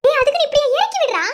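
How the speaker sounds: high, loud startled cry; half-laughing protest, put me down; wife shrieking at husband who lifts her up. Acted. Wife protesting being lifted up.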